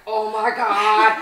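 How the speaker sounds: loud, slow, gushing gasp; a fan spotting a star, belting it out; starstruck fan squeal. Retold or imitated. Registration desk girl recognizing the actress, loud excited gush. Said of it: "the girl sitting at the registration desk was like".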